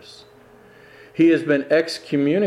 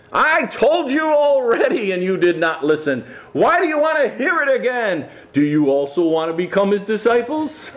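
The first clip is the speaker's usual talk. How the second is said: raised, a touch heated retort; lashing out; 8 semitones higher than usual. Acted. Healed man retorting to the needling Pharisees, mildly heated. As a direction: snap back at them, half amused. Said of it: "he lashes out".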